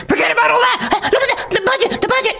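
very high, thin parody voice repeating itself; mocking Steve Bannon. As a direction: whine it like a cartoon pundit. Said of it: "Oh, I'm Steve Bannon".

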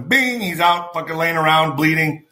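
ordinary talk, nothing marked

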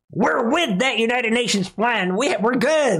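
high-pitched fake agreement, laughter bubbling under; mock enthusiasm. Mocking Saudi support for the United Nations plan, gleefully insincere. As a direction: cheer along in a hammed-up high voice.

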